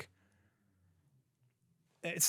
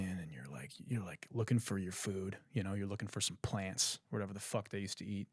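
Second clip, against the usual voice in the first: hushed storytelling; 5 semitones lower, 9 dB quieter than usual. Acted. Telling viewers a jungle dinosaur story, hushed and creepy. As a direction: drop to a near whisper, softer, building dread.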